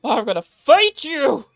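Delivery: loud mock threat; playful shout. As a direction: shout it like a cartoon brawler.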